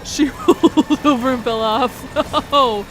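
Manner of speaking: loud, slow words through laughter; laughing. Real, not acted. Streamer laughing at a chatter's cat story, talking through laughter.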